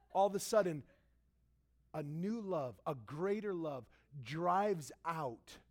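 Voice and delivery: ordinary talk, nothing marked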